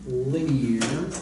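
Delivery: "linear" drawn out slowly with a rising end; slow, deliberate weight on "linear"; emphasis on linear. Real, not acted. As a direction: stretch out "linear" as the key word. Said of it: "Emphasis on the word".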